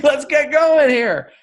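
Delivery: high, drawn-out impatient yell; mock exasperated shout, stretched out; impatient New Yorker. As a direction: hurry them along in a hammed-up holler. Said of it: "The New Yorker's like".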